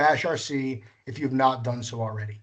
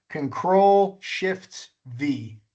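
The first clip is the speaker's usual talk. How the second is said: slow, spelled-out dictation; much slower than usual. Shown as a demonstration. Dictating the key combination.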